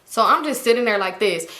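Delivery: ordinary talk, nothing marked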